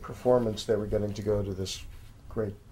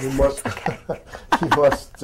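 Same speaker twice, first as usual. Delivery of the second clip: put-on higher, louder French accent breaking into laughter; laughing French-accent attempt; 5 semitones higher, 6 dB louder than usual. Host giving up on copying the French restaurateur, laughing French-accent attempt. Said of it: "should attempt a French accent".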